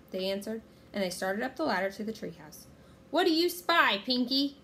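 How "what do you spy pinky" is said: loud, raised pirate captain's call; commanding pirate shout. A narrator's reading. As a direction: holler up to a crewman. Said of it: "shouted Captain Bones".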